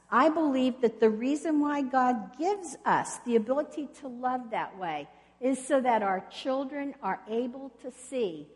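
ordinary talk

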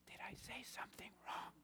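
hushed, breathy worry of a newcomer; whispering. Retold or imitated. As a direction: whisper it, afraid you slipped up. Newcomer confused, church staring.

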